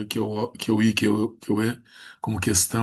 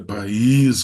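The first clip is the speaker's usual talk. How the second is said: país with a loud, held Í; país, the second syllable punched louder; strong I; 6 dB louder than usual. Shown as a demonstration. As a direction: lean hard on the Í in "país". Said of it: "como o i é forte".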